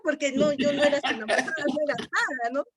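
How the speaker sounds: talking fast through a real laugh. Real, not acted. To the interviewer, amused at her own trick: laughing fast. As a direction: laugh it out as you explain, a bit rushed. Laughs recalling the absurd scene.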